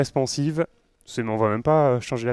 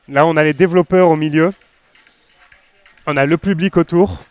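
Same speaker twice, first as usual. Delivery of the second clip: louder, projecting over a loud video; raised voice over music; 6 dB louder than usual. Real, not acted.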